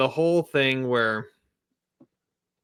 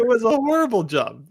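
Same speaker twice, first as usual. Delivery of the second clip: high, louder, amused outrage; a lively pitched-up jab; emphatic agreement; 10 semitones higher, 4 dB louder than usual. Real, not acted. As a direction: pile on the mockery. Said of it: "Horrible Photoshop on his hair".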